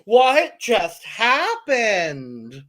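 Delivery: badly faked Australian, very slow; amateur Australian impression, stretched wide; attempted Australian accent. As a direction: draw every word out in a put-on Australian accent. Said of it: "but an Australian accent".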